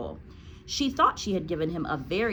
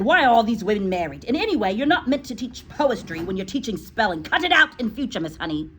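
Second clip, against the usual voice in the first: loud, quicker scolding reread; re-read scolding; 5 semitones higher, 5 dB louder, faster than usual. Retold or imitated. Teacher rereads the Trunchbull's rebuke to the class, loud. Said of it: "that's why she said".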